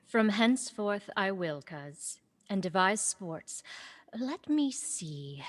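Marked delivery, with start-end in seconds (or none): 4.2-5.4 breathy whisper to herself. Acted. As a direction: whisper it as an idea forms. Celia muses on a new sport.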